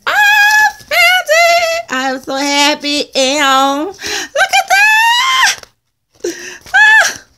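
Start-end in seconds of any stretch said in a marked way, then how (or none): 0.1-1.9 high, slow, loud squeal of joy; ecstatic squeal. Real, not acted. Crafter shrieking over her new pad, high squeal. Excited find of sticker pad. 3.1-7.2 high, loud, slow laughing shriek; excited shriek. Real, not acted. Crafter showing off her pad, laughing shriek.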